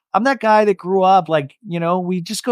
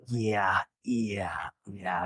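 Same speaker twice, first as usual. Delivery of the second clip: much lower and fading softer; fading reluctant yeah; 8 semitones lower, 12 dB quieter, much slower than usual. Retold or imitated. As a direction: trail off, unwilling. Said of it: "She's like".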